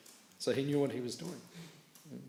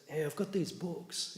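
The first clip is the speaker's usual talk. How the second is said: higher, slow and casual, hey drawn out; friendly offer; 7 semitones higher, slower than usual. Retold or imitated. Monk Navina casually offering books to the car owner, slow and higher. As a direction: slide the books in easily. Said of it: "he goes".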